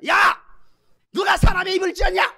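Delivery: high, shouted rebuke; exasperated God. Acted. God rebukes a reluctant Moses, exasperated shout. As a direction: bellow like a God losing patience. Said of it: "하나님이 이제 스트레스를 받으시는 거예요".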